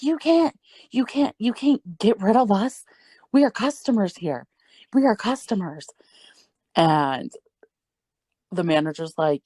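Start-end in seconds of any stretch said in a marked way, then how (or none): 0.0-5.9 high-pitched mock outrage; indignant protest. Customers protesting being escorted out, high-pitched and indignant. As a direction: imitate whiny, worked-up indignation.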